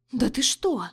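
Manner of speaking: a surprised little gasp; gasping in surprise. A narrator's reading. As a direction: gasp it out, astonished. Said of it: "ахнула подруга".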